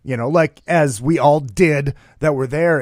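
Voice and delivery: normal talking voice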